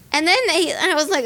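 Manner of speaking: ordinary delivery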